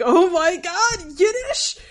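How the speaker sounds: thrilled to hear Yiddish on TV; excited gasp. Retold or imitated. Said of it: "And I was like".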